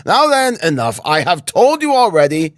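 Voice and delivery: loud, high, impatient; angry cutoff. A narrator's reading. Assistant silencing the landlady, angry. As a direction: shut her up sharply.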